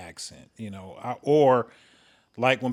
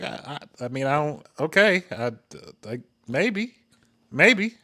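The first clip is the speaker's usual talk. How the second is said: hesitant reply. Retold or imitated. Recounting an unsure answer to a big compliment, hesitant.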